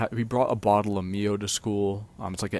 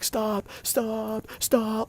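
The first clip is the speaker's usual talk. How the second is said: very high shrieked stops; imitating a shrill scream much higher; imitated frantic scream; 12 semitones higher, much slower than usual. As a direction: mimic the wife screaming at him to stop. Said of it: "at the top of her lungs".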